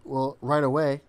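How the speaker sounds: ordinary talk, nothing marked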